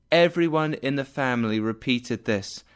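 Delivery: usual speaking voice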